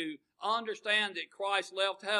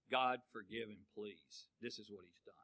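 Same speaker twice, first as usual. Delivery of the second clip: soft pleading; 9 semitones lower, 10 dB quieter than usual. Retold or imitated. Voicing Jesus pleading before God.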